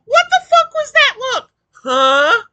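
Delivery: high, loud outraged yell; furious shout. Real, not acted. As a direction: yell in disbelief. Outraged reaction to clip.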